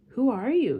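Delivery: loud, high astonished question; astounded. A narrator's reading. The little prince astounded by the roses, loud surprise.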